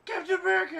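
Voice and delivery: high, loud gleeful cry; mock excited shout. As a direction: cry the name out up high, thrilled. Imitating Deadpool's excited shout.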